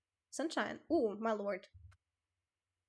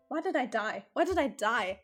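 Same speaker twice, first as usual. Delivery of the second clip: loud lament; 8 dB louder than usual. Real, not acted. Cuts into epilogue narration.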